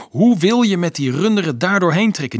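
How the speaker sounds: high, loud challenge; challenging question. A narrator's reading. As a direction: throw the question out forcefully. Challenge in a quarrel.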